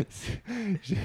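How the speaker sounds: high giggling, trying to speak; laughing too hard to talk. Real, not acted. Still laughing after the joke.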